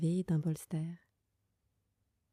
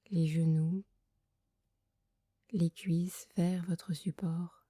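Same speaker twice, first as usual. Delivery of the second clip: guided meditation. Acted. Leads a Yoga Nidra meditation. (voix douce et lente de méditation guidée)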